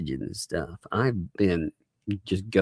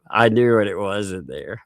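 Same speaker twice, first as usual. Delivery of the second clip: normal talking voice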